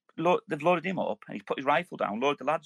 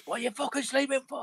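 high shouted telling-off, half laughing; mock angry yell; 12 semitones higher than usual. Sergeant catching a sleeping soldier on stag, mock yell. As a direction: bark it like a furious sergeant.

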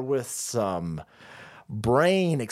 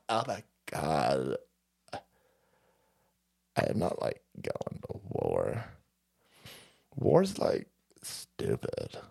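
much lower, creaky vocal fry drawl; 10 semitones lower, 7 dB quieter, slower than usual. Acted. Mocking Gen Z refusing war, much lower creaky vocal fry. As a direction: creak out the words lazily. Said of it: "It's a generation vocal fry".

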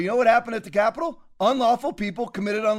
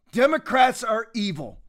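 normal talking voice

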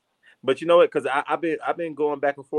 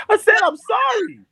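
high, loud repeated apology; angry apology; 7 dB louder than usual. Real, not acted. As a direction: shout the apology back defensively.

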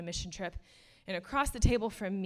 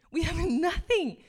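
emotional laugh; 9 semitones higher, 8 dB louder than usual. Real, not acted. Emotional preaching point.

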